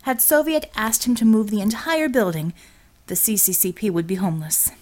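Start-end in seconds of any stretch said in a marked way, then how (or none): none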